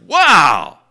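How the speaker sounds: a churchgoer stunned by a miracle; astonished wow. Retold or imitated. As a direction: cry out in stunned amazement. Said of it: "you'd be like".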